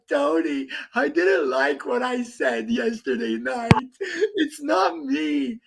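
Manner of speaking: imitating a weepy drunk, voice cracking into a sob; mock crying. Mocking a drunk friend's tearful apology, sob-voiced. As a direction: ham up a blubbering apology, slowing at the end. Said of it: "and he's crying like".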